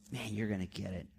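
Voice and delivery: low, hushed mock menace; quiet teasing threat. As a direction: growl it low like a promise of payback. Psalmist's threat voiced by preacher.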